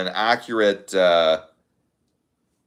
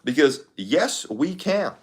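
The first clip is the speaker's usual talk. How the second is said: slow higher Obama slogan, trailing softer; Obama impression; 4 semitones higher, 3 dB quieter than usual. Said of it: "the Obama impression is way easier".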